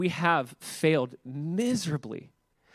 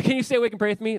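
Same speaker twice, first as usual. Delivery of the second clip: higher, louder urgent plea; 6 semitones higher, 4 dB louder, faster than usual. Retold or imitated. Jesus begging sleepy disciples to pray, urgent and louder. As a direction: press them to stay awake with you.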